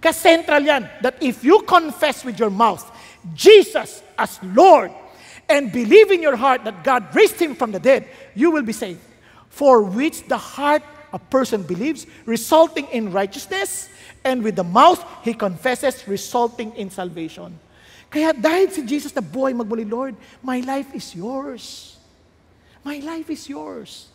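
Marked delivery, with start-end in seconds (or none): 0.0-17.5 loud, slow, weighty declaration of scripture; emphatic scripture preaching. Real, not acted. 19.8-24.1 soft, very slow devotional plea; prayer of surrender. Acted. Acted prayer of surrender to Lord.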